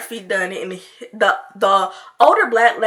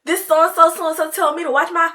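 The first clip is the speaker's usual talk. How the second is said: imitating a fast, higher-pitched angry rant; mock mad rant; 7 semitones higher than usual. Retelling the nail tech's cursing at the old lady, mock mad. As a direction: rattle off censored curses as if fuming. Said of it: "really mad".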